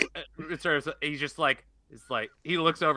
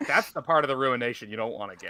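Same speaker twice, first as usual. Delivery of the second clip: ordinary delivery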